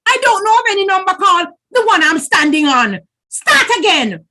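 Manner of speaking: irritated spider barking orders; angry order. A narrator's reading. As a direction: order her to start over. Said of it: "Anansi ordered".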